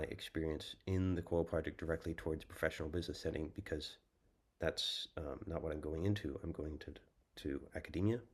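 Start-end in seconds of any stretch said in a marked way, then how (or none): none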